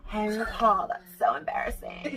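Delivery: giggly whispered Paris Hilton voice; Paris Hilton impression. Quoting Mick's Snatch Game Paris Hilton, whispered and giggly. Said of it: "goes in and out of, like, a deep voice to her high voice".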